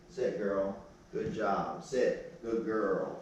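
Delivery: low, quiet coaxing of the dog; calm her down gently; soft praise. Real, not acted. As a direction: trainer softly praising the dog. Quiet praise during training.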